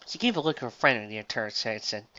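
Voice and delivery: ordinary delivery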